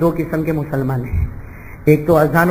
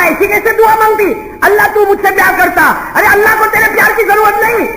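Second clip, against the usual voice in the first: scolding; 12 semitones higher than usual. Retold or imitated. Master scolds the praying girl.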